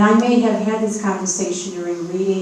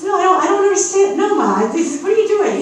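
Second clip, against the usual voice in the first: high, loud, exasperated daughter; imitating daughter; 11 semitones higher, 5 dB louder than usual. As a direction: throw up your hands as the confused daughter. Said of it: "She says".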